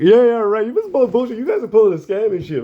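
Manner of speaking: high-pitched mock panic; freaked out, near tears. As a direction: imitate a friend freaking out, almost crying. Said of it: "He almost started crying".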